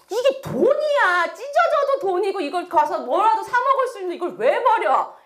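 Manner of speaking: high, indignant protest; angry second son. Acted. Second son protesting the money being thrown away, angry. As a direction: argue it out like an exasperated brother. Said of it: "둘째 아들은 막 화를 내고".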